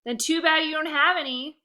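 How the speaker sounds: brother's retort, high and loud; snappy comeback. Acted. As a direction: fire back the insult. Comeback to sister.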